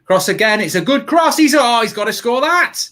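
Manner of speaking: rising excitement at a chance; excited. Real, not acted. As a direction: voice jumps up, nearly shouting. Live goal chance while watching.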